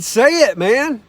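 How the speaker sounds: cheering a shy co-writer on to say it; urging. Retold or imitated. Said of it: "And I said".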